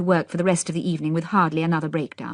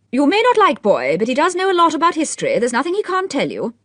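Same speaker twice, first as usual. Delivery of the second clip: lady Mondor defending; 9 semitones higher, 5 dB louder than usual. A narrator's reading. Lady Mondor defending Boy, high and gushing. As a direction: gush about Boy.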